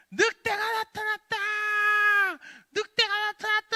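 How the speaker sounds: high, loud, long-held cry of alarm; shouting alarm. Acted. Boy crying wolf again to villagers, shouting alarm. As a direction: holler it out to summon everyone. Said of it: "막 소리를 지르는 거예요".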